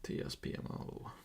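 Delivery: voiceless whisper of the letters; hushed, whispered spelling-out. Real, not acted. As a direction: whisper each letter under your breath.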